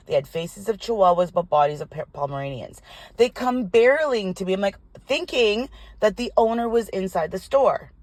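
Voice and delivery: ordinary delivery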